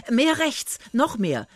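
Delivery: put-on boy calling out orders; calling directions. A narrator's reading. Willi directs the rowing man.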